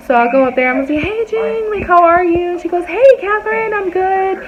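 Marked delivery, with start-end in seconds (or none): 1.0-2.5 sweet greeting. Retold or imitated. Greeting the owner's wife in her office, sweet. 2.9-4.4 high, friendly reply, softening at the end; sweet. Retold or imitated. Owner's wife greeting an employee, sweet. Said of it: "she was always sweet to me".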